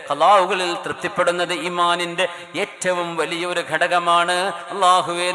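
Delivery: normal talking voice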